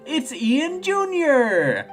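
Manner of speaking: high, drawn-out excited cry; real excitement, pitch soaring; delighted recognition. Real, not acted. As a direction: squeal it slowly with delight. Delighted at spotting a character.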